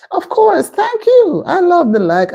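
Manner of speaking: very high-pitched, delighted gush; delighted thanks. Real, not acted. Thanking viewers for likes.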